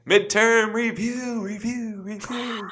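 high announcer echo fading away; echo effect. Pretend. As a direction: boom it out, then let it echo. Drum roll, echoing title announcement.

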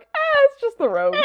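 high-pitched, put-on shrug of a line; comic high dismissive quote; mock dismissal. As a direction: brush it off in a squeaky mock voice.

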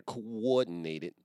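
"Coordinated" drawn out slowly, every syllable clear; careful pronunciation. Shown as a demonstration. Correcting his own word for listeners, slow careful "Coordinated". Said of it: "You gotta say coordinated".

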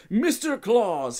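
high, slow startled greeting; startled elf greeting his boss; surprised and terrified. A narrator's reading. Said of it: "both surprised and terrified".

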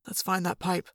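soft hushed whisper. Acted. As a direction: keep it low and hushed. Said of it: "Jake whispered".

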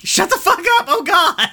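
shrieking very high and loud, flustered; embarrassed Steven. Retold or imitated. Steven yelling at the teasing Gems, embarrassed high shriek. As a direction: squeal in mortified panic like a teased kid. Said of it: "And he's like".

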